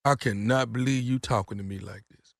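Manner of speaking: low, soft and hurt; mock calm. Prankster suddenly drops to a low, soft, wounded voice. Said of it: "I am matching your tone".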